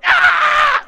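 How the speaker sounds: very high, piercing shriek; imitated intense scream. Storyteller imitating the noise as it grows, piercing scream. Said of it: "And now it's going".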